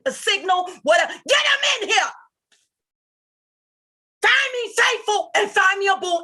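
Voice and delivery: high, pleading shouts for someone to fetch him; real yelling. Real, not acted. Begging the chat to find G-Man, real upset yelling. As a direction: yell at the room, desperate and upset. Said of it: "I didn't mean to yell".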